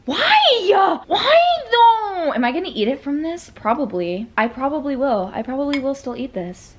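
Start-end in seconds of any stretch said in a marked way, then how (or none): 0.1-2.3 high loud wail of despair; real, loud, high-pitched lament; despairing shout. Real, not acted. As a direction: cry out to the heavens. Wails over the spill.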